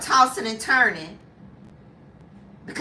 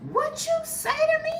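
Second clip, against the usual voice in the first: sharp, much higher and offended; offended wife. Retold or imitated. Wife, stung by her husband's menopause jab, high offended comeback. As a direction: snap back like she's insulted. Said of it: "And she said".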